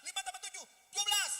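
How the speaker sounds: high, shouted questions and answers; rapid-fire sum drill. Retold or imitated. Father drilling the quick younger sibling, shouted sum drill. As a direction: bark out sums and instant answers. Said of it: "Adik saya tuh kalau tambah-tambahan cepat".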